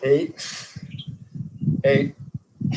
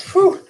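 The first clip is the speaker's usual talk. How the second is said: relieved whew; 4 dB louder than usual. Real, not acted. Exhale after hard set.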